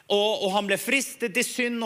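ordinary talk

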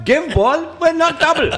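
high put-on accent, lightly done; silly foreign accent for a joke; Indian accent. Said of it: "That accent".

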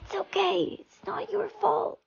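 soft, high, strained comfort; failing-voice reassurance. Acted. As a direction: slowly squeeze out forgiveness. Dying friend forgives with failing voice.

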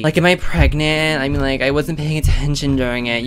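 usual speaking voice

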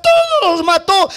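high, stretched-out cry of disaster; messenger's dramatic cry. Acted. Messenger reports the massacre. (grito dramático y alargado)

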